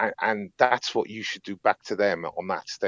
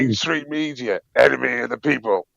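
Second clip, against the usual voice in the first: louder, mock heckling call; retold heckle; 4 semitones higher, 6 dB louder than usual. Telling friends about heckling the media as he walked past, mock shouted jibe.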